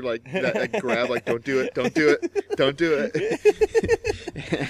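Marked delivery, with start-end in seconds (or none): 1.2-4.7 high, slow stretched mock plea; pleading Lisa. Lisa warning him at the desk, a high drawn-out mock plea. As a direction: drag out a squeaky don't do it. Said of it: "I could feel Lisa's hand on my shoulder".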